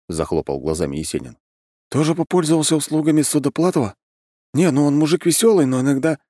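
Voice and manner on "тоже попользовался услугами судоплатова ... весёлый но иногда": high, lively character voice; puzzled friend guessing. Acted. Friend guessing what the newcomer means, high puzzled voice. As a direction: guess aloud in a raised voice.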